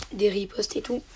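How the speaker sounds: ordinary talk, nothing marked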